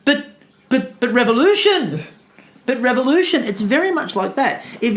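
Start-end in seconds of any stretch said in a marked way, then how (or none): 0.1-3.4 whiny protest. Retold or imitated. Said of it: "Trotsky's standing there going".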